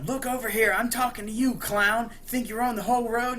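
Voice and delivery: mock road-rage rant; retold angry yelling. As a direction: act out an irate driver telling someone off. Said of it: "just starts yelling at it".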